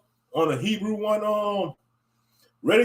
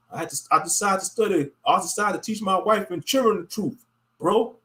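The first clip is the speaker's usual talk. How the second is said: ordinary talk, nothing marked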